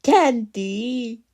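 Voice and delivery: high and loud, a gasp of delight; gasp, then gush over the find. Acted. Finding bacon candy, gasping with delight.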